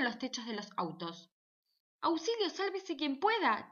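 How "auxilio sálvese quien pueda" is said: shrieking drivers. Acted. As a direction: squeal in alarm as you duck behind trucks. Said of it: "chillaban los que manejaban".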